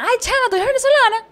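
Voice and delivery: very high, loud mock exclamation; imitated excited Venezuelan. Venezuelans mistaking the speaker for their own, high excited imitation. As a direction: squeal it like an amazed Venezuelan. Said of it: "por el acento".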